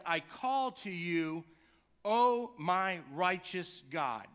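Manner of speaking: slow, earnest reading of the psalm; measured prayerful recitation; scripture reading. Acted. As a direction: read each line slowly like a prayer. Reads the psalm aloud.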